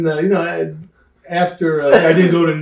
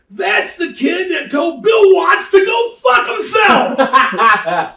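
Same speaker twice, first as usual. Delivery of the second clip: high, loud, slow gleeful shout with laughter; retold laughing yell; 13 semitones higher, 4 dB louder, much slower than usual. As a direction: yell it out laughing to the whole room. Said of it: "And Bearcat yells out".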